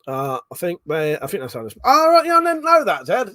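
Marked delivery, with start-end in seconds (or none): 1.8-3.3 sudden high pitched oh right; surprised. Real, not acted. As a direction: caught off guard by the fact, voice jumps up. Reacting to Zed's chat fact.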